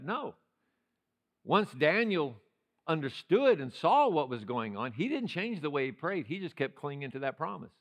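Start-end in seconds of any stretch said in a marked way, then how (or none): none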